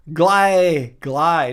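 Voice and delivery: "gly" with a long eye vowel; imitated mispronunciation. Streamer quoting the doctor's error, "gly" with an eye vowel. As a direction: mimic "gly", rhyming with sky. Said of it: "though, he said".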